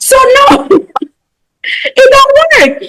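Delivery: high laughing aside that it fails. Real, not acted. Laughing to the group that it fails.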